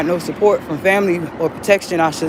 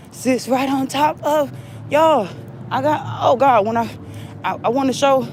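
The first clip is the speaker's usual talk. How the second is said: excited; 7 semitones higher than usual. Real, not acted. Excitedly revealing her house location.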